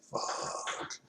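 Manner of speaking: breathy whispered fuck, almost no voice; whispered curse. Retold or imitated. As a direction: let it out under your breath, stunned. Retelling his shock at the video.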